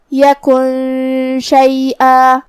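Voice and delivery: یکن شیئا chanted very slowly, nun held as a nasal hum; hidden nun with ghunna. Shown as a demonstration. Teacher demonstrating the hidden nun rule, slow nasalized chant. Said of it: "نون کو چھپاتے ہوئے اور غنہ کے ساتھ".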